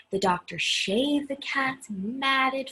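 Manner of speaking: ordinary delivery, nothing marked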